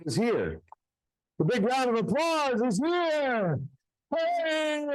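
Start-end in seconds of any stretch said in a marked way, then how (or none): 1.4-3.6 showman cue. Acted. Cueing applause after trick. 4.1-4.9 cheerful hey. Real, not acted. Calling for applause.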